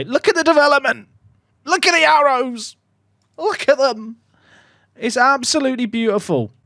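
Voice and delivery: high, loud, fast gushing; thrilled, show everyone the stats; excited marvelling. Real, not acted. Marvelling at player growth.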